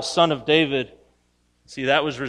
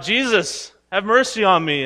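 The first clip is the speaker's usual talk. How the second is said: higher, raised pleading call; imitated cry for help, pitched up; 6 semitones higher, 5 dB louder than usual. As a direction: call out again for mercy, acted. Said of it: "He cries out".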